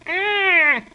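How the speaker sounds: sudden high yelp of fright; high scream. Acted. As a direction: shriek as the board gives way. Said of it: "he lets out a scream".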